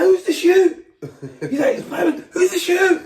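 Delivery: high, loud shout across the crowd; retold hype man yelling from the stage.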